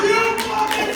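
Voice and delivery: very high-pitched thrilled outburst; acted overexcited fan; fan's excited squeal. Wrestling fan reacts to finishing move.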